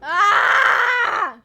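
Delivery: very loud, high shriek; scream. Real, not acted. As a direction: let out one long piercing scream. Screams while playing the game.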